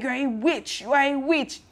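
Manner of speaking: high-pitched, hammed-up accusing cry; mock furious accusation. Recapping the rival wife's outburst, high-pitched mock accusation. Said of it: "Oganya loses it".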